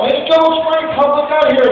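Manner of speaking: high, loud mock crowd shout; calling out. Crowd summoning the football star, calling out. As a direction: holler up for someone's attention.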